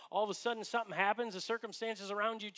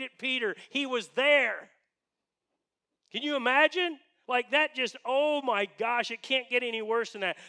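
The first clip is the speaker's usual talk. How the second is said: emphatic pulpit delivery, high and forceful; impassioned preaching; 4 semitones higher, 7 dB louder than usual. Real, not acted. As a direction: drive the dramatic moment home to the congregation. Preacher builds to the dramatic moment.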